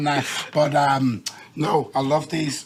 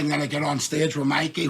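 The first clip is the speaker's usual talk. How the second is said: usual speaking voice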